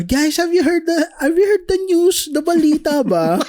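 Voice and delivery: high, airy conyo voice mocking a show-off; high mock conyo excitement; mock conyo girl. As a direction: play a vapid conyo gossip. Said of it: "Yung parang".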